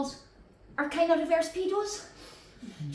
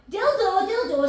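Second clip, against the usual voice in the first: mock shout; 5 semitones higher, 4 dB louder, slower than usual. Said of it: "this lassie's just gone".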